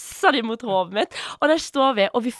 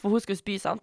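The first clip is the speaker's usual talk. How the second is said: normal talking voice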